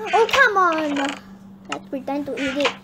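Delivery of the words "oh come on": loud, raised-pitch complaint; exasperated protest. Pretend. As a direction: groan it out in protest. Prisoner protests the meal.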